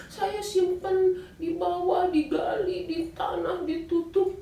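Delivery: high, slow, ending in a whisper; sneaky admission. Acted. Achan tells the messenger where he buried it, high and secretive. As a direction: confide the hiding spot.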